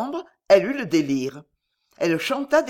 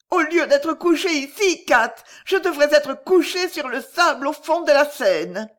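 higher, louder despairing voice; delirious young girl; 8 semitones higher, 5 dB louder than usual. A narrator's reading. Delirious Lydie tells Kat she should be in the Seine, despairing. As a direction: cry out your wish to drown, raw.

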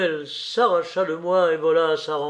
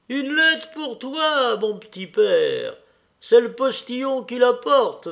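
voix de vieille femme plus aiguë, un peu lente; la servante Matrena annonce une lettre; 9 semitones higher, 5 dB louder than usual. Acted. (Matrena parle à son maître, voix de vieille servante)

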